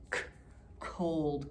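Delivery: hard k split off, then cold said softly; teacher stressing the first sound of cold. Shown as a demonstration. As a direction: break off the K- before the whole word. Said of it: "Cold starts with C".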